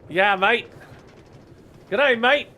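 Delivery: hammed-up Australian accent; mock Australian accent. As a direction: play a cheerful Australian greeting. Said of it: "Went to Australia for a second".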